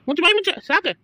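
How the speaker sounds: sharp, high, loud yell to hush; angry shout. Acted. Scolding a crying child in public, loud angry shout. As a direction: snap at the wailing child to shut up.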